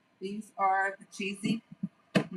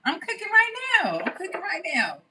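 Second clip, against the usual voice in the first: much higher, loud hype; excited bragging; 11 semitones higher, 6 dB louder than usual. Acted. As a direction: hype yourself up. Excited chant.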